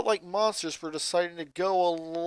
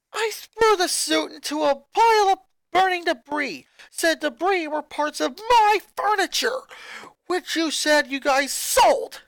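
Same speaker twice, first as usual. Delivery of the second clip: put-on high, loud furious boy shouting at family; a boy exploding at his mother; angry boy ranting; 13 semitones higher, 10 dB louder than usual. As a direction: snap back at Mom, furious. Lincoln accuses mother.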